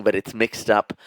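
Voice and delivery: normal talking voice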